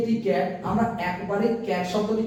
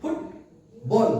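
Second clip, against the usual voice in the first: "Football" as two separate beats, ফুট, বল; split into syllables; much slower than usual. Shown as a demonstration. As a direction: say "football" slowly with a gap between ফুট and বল. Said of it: "দুটো syllable".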